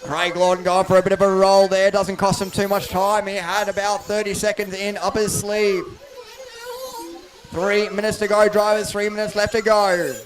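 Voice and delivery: live play-by-play over the PA; race commentary. Real, not acted. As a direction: call the race as it unfolds.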